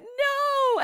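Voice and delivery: high startled yelp of refusal; a sudden high yell of protest; shouted no. Acted. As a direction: cry out no as you're grabbed. Man yells refusal at rescuer.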